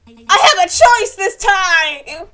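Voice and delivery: loud, slow, delighted, with a laugh; thrilled. Real, not acted. Streamer thrilled to refuse a request: loud laughing delight. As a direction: savour the freedom, laughing with joy.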